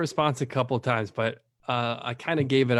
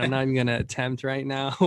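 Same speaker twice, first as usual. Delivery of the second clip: ordinary delivery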